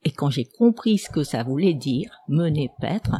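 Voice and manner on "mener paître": whispered. Retold or imitated. Shameful phrase recalled, said unvoiced.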